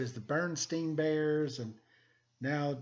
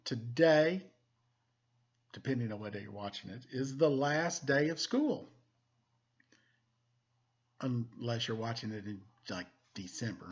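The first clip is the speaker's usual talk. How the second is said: usual speaking voice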